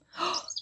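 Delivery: quick, soft sharp intake of breath; sharp gasp. Acted. Reader gasping as the rabbit wriggles free. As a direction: gasp at the narrow escape. Escape at the last moment.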